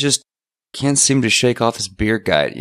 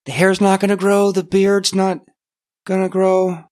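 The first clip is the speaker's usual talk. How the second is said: much higher, worried questioning; dismayed disbelief; 9 semitones higher than usual. Acted. Alex learns his body is frozen, high dismayed questioning. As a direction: ask it high, as the bad news sinks in.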